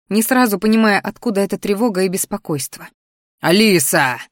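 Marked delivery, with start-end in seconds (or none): 3.4-4.2 low growl of a dragon calling out. A narrator's reading. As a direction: growl the name like a beast. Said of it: "зарычал Арагон".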